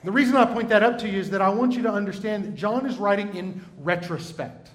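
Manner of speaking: normal talking voice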